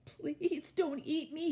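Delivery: high-pitched little goat squeak; frightened squeak. A narrator's reading. Baby goat begging the troll for his life, squeaking. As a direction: squeak it out, small and pleading. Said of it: "he squeaked".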